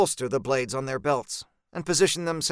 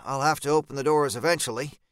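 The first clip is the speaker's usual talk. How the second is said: plain reading voice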